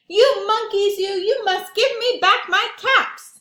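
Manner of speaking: high, loud, furious yell; angry shout. Acted. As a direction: shake both fists and yell at them.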